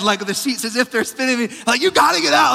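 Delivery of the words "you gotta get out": high, loud frantic warning; screaming. Retold or imitated. Husband yelling in his sleep at his wife, screaming. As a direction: re-enact a sleep-yelled alarm. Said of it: "Like, I'm screaming".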